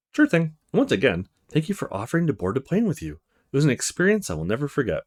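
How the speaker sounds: ordinary talk, nothing marked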